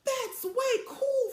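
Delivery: high, slow, amazed "way cool"; big sister declaring it way cool; impressed declaration. Acted. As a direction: stretch it out, truly impressed. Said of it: "Frida declared".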